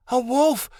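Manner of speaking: sudden high cry of discovery; cry of alarm. A narrator's reading. Scene: Amira discovers Shadowin is a wolf, crying out. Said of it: "she cried".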